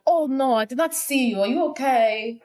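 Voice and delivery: high-pitched, hammed-up Russian accent; bad Russian accent. Voicing Olga after she crashes into William, bad Russian accent. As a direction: put on a terrible Russian accent. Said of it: "That's a terrible accent".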